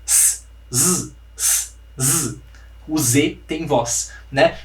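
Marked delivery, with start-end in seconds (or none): 0.1-2.4 S voiceless hiss, z buzzed with voice; S and Z contrast. Shown as a demonstration. Teacher contrasting voiceless S and voiced Z. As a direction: alternate a hissed S and a voiced z. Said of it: "Essa é a diferença".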